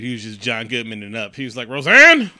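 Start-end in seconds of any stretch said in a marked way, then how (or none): 1.7-2.3 high, loud sitcom holler; imitated yell. Film fan imitating John Goodman, imitated yell. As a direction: holler the name in mock alarm.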